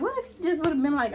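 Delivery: ordinary delivery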